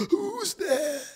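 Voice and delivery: put-on an old man jolted awake, calling into the dark; frightened cry. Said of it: "crying out".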